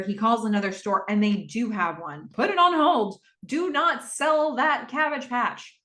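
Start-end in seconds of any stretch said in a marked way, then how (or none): 2.3-5.8 louder, high, insistent command down the phone; urgent order. Retold or imitated. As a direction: demand they hold it, urgently.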